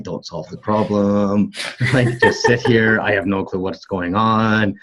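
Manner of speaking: slow, deliberate inner reminder; coaching himself. Retold or imitated. Reminding himself to just listen to his wife, slow inner voice. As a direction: talk yourself down calmly and slowly. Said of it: "I'm like".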